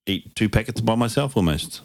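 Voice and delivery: usual speaking voice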